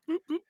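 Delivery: high, soft boops; two little high button beeps; imitated beeps. Gamer buying a game at the store, imitated beeps.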